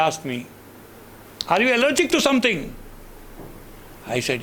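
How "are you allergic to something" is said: high, loud and fast nurse question; a busy nurse asks briskly. Retold or imitated.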